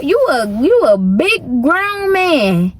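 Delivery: ordinary talk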